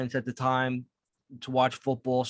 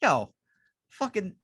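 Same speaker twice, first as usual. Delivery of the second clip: joking friend; 8 semitones higher than usual. Retold or imitated. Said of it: "Malarsic was like".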